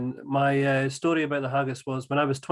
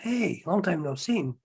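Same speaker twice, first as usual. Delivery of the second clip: higher, bright mock girl voice; American girl's greeting; 5 semitones higher, slower than usual. Old friend greets him at the bar reunion, higher mock girl voice. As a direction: imitate her surprised hello. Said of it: "And she was like".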